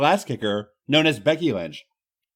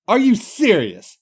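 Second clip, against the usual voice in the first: slow, disbelieving bartender, pitched up; obvious displeasure; 6 semitones higher, 5 dB louder, slower than usual. Acted. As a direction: let the displeasure drag out. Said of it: "with obvious displeasure".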